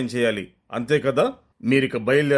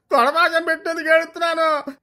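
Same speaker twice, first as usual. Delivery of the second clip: wailing prince; 13 semitones higher than usual. Acted. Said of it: "Yuvaraju లబోదిబోమంటూ".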